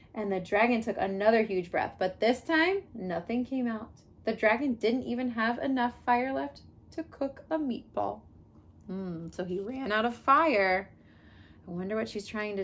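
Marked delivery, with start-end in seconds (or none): none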